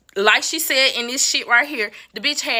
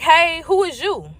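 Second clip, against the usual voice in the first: higher, slow, pointed questioning; blunt challenge; 6 semitones higher, slower than usual. Retold or imitated. Woman demanding who the stranger at her door is, pointedly. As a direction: re-enact confronting an unexpected visitor. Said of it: "I say it just like that".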